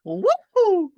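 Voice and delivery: high, loud cartoon woohoo; Mario cheer.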